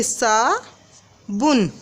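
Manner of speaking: very slow, syllable by syllable; drawn-out spoken spelling; slow dictation. Shown as a demonstration. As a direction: dictate it slowly. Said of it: "कैसे लिखेंगे".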